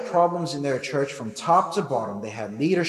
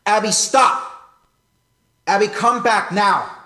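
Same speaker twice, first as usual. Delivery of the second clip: urgent command; 6 semitones higher, 9 dB louder than usual. Retold or imitated. Father calling daughter back from a busy street, urgent firm shout. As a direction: stop a child running into the road. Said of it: "That's the tone I take when she lets go".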